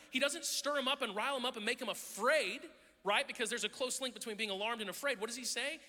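normal talking voice

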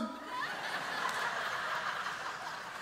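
real laughter at the story; soft amused laugh; quiet real laugh; breathy laugh. Real, not acted.

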